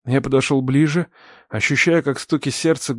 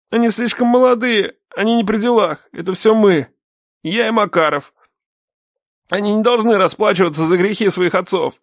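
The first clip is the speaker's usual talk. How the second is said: higher, urgent appeal; earnest pleading; 7 semitones higher than usual. A narrator's reading. Captive begs the father to spare the sons, pleading. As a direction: plead for the young, pitched up.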